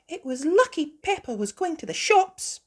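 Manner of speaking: high, loud sheep-mother voice; Mummy Sheep. Acted. Mummy Sheep grateful for the shopping, high voice. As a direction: pitch it well up.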